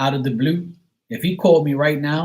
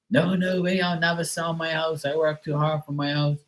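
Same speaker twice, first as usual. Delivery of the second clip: put-on higher, stubborn old father voice; stubborn old dad; 4 semitones higher, 4 dB quieter than usual. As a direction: play the dad refusing flat out. Dad refusing to sell house.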